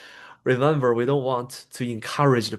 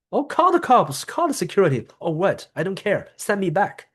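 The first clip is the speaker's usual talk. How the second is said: much higher and louder, a mocking challenge; defiant outburst; 8 semitones higher, 3 dB louder than usual. Upset person escalating against staff, defiant. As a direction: dare them to call security.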